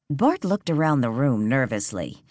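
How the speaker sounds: ordinary delivery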